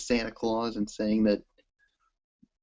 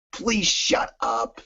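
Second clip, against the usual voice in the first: mock dismissive; 8 semitones higher, 4 dB quieter, much slower than usual. Fan voicing Rollins telling Cena off.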